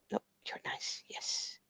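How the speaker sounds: soft unvoiced whisper to a pet. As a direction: whisper coaxingly to the dog. Whispering to the dog.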